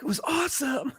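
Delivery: mock their answer in a high voice; high mock bandmate voice. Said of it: "And you guys were like".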